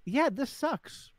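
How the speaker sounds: drawn-out slow commiserating yeah; sympathetic yeah. Retold or imitated. Husband shows how to commiserate with his wife, slow and drawn-out. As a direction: stretch it out slowly, high and sympathetic. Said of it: "just be like".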